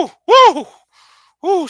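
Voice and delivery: high whooping "woo"; short, repeated high whoops; excited whoop. Real, not acted. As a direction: whoop in appreciation at a voice. Reacting to the sexy voice clip.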